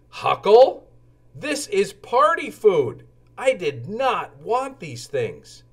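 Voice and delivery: a high, slowed, gently scolding mother; mock surprise at the wrong groceries; mild dismay. Mother unpacks wrong food.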